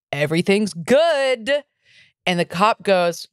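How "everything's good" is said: slow answer, stretched on GOOD; retold drawn-out reassurance. Boyfriend answering the cops, slow drawn-out reassurance. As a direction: draw out "good" as the reply to police. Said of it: "And he's like".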